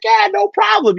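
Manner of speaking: high mock salesman voice, retold; Terrence voiced high. Telling how the salesman answered him, in a high put-on voice.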